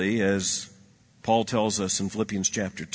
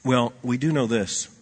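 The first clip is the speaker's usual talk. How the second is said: ordinary talk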